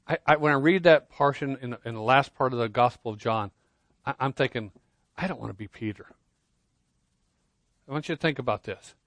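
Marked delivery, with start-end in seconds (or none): 5.2-6.1 quiet inner thought. Retold or imitated.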